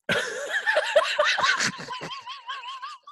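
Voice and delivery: high, wild cackle of laughter; screaming with laughter; shrieking laugh. Real, not acted. Howling at the memory.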